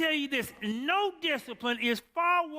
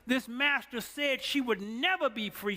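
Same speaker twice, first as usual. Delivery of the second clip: ordinary delivery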